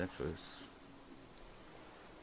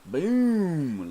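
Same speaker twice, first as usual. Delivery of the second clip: excited boom; 11 semitones higher, 8 dB louder than usual. Real, not acted. Reacting to a sharp sell-off.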